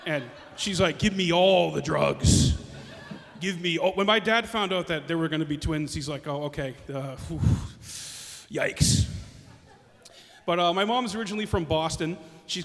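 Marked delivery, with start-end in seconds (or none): 1.0-3.9 slow, stretched-out mock demand; drawn-out demand. Mom giving birth to twins, slow mock demand. 6.3-9.1 mock overwhelmed. Dad hearing twins are coming, mock overwhelmed.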